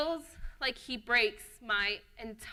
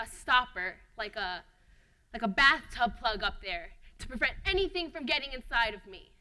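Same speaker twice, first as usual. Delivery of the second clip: normal talking voice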